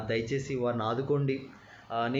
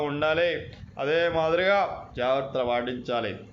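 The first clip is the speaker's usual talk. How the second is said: louder, earnest politician's appeal; imitating Chandrababu Naidu, louder speech voice; Chandrababu Naidu impression; 3 semitones higher, 4 dB louder than usual. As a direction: address the brothers like a leader. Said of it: "Nara Chandrababu Naidu గారు".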